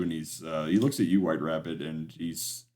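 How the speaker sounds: normal talking voice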